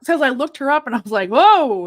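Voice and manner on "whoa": loud, higher retold "whoa"; big reenacted double-take; startled whoa. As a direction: blurt it out as if taken aback. Retold reaction to seeing the doll.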